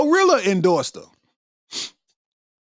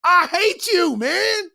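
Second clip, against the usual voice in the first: mock exasperated shout; 10 semitones higher, slower than usual. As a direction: cry out in mock disbelief. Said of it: "I'm like".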